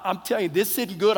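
normal talking voice

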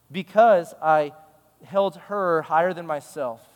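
normal talking voice

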